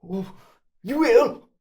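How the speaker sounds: high, loud relieved yelp; cornered thug giving in. Acted. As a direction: squeal your surrender. Thug gives in to the hero.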